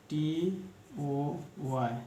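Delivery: letter by letter, very slowly; slow spelling. Shown as a demonstration. Teacher spelling out a word for students, slowly. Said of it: "teacher spells word slowly".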